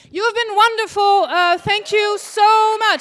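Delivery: comic thanking the audience loudly; loud sign-off. Real, not acted. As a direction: belt the thanks to the crowd. Closing sign-off to applause.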